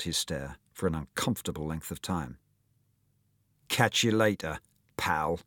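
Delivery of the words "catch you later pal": understated snarl. Acted. Bully leaving after a stare-down, understated snarl. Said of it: "Devlin snarled".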